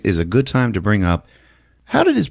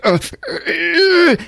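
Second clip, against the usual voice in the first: mock anguished groans. Sam losing it.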